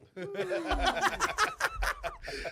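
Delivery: long, high, loud laughter. Real, not acted. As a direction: crack up hard. Laughs at boofing joke.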